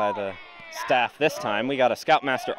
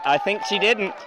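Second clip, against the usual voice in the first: excited shout; 8 semitones higher than usual. Real, not acted. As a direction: call it out mid-rally, unsure. Disputed touch during live rally.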